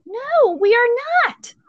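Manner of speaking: high-pitched NO, then firm spaced words; retold emphatic refusal. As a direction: shoot it down, pausing before "not".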